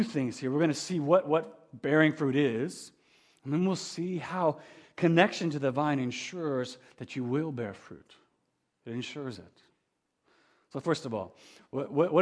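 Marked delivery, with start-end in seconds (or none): none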